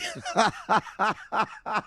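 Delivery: high cackling laugh in a long run; uncontrollable laughter. Real, not acted. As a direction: burst out laughing helplessly. Cracks up at the imagery.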